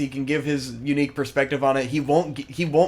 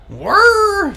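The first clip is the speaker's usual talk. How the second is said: whirring robot servos; 8 dB louder than usual. Retold or imitated. Game enemy robot moving.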